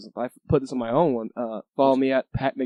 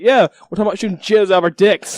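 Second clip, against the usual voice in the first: mock boast; 5 semitones higher, 7 dB louder than usual. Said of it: "I was like".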